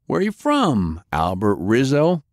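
Albert Rizzo tossed back high and rising; mocking question. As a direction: sneer the name back at him. Interrogator mocks the name back.

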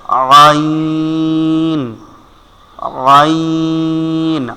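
very slow throaty ʿayn; letter demo. Shown as a demonstration. Teacher draws out আইন very slowly for students. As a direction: stretch আইন from the middle of the throat. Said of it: "আইন কোথান থেকে উচ্চারণ হবে".

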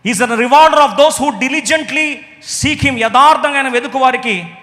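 loud, slow, high proclaiming; preaching at full force. Acted. Preacher proclaiming to the congregation, slow and loud. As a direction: thunder the verse out slowly.

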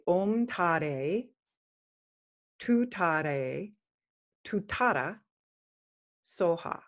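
teaching the mantra, each phrase set apart by pauses; Tibetan mantra pronunciation. Shown as a demonstration. Teacher shows the listeners the Tibetan mantra pronunciation. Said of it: "I will be using the Tibetan pronunciation".

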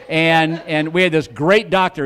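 usual speaking voice

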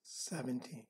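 soft spa whisper. Acted. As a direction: guide them into calm, breath by breath. Leads a counted relaxation meditation.

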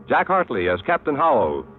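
ordinary talk

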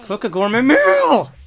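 frustrated shout; shouted command. Real, not acted. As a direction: yell the order, fed up.

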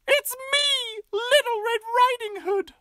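put-on very high sweet child voice; little girl voice. Red answering at the door, high and sweet. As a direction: chirp like a small girl.

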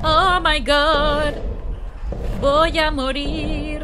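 slow, high, drawn-out wail; lamento de miedo. Real, not acted. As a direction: stretch each word in singsong dread. Dread while playing horror game. (quejido agudo, lento y alargado)

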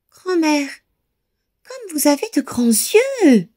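put-on a wide-eyed girl marvelling; exclaiming girl. A narrator's reading. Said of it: "s'exclama-t-elle". (fillette qui s'exclame, voix aiguë)

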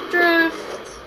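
loud, high racing shout; excited call-out. Real, not acted. Calling out a move while racing.

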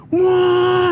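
loud high train blast "Wah!"; sudden mock train horn; imitated train horn. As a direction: blare it like an oncoming train.

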